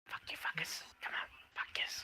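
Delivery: voiceless stage-whisper shout; whispered yell. Acted. Player plays along with the quiet-yell joke, whispered shouting. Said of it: "So quietly yelled".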